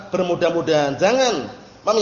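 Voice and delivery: usual speaking voice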